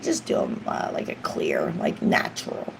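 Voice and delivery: quiet unvoiced telling the nail tech; whispered request. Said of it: "I was like".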